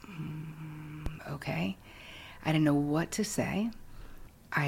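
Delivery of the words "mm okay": much softer, breathy whispered okay; lost for words, barely voiced; stunned whisper. Newcomer reacting to a shocking confession, whispered.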